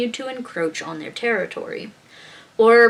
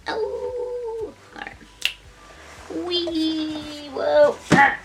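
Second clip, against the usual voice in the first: playful squeal; 8 semitones higher, much slower than usual. Real, not acted. Spinning in chair.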